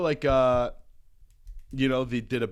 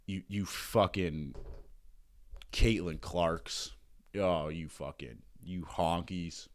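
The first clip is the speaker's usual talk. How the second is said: low, slow mock-menacing muttering; muttered mock slurs; 6 semitones lower, 4 dB quieter, slower than usual. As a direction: growl it under your breath, deadpan.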